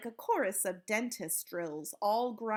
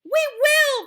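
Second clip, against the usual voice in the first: high, loud eager assent; cried out; 16 dB louder than usual. A narrator's reading. Witches promising their leader, cried out. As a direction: cry out obediently. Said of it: "they cried".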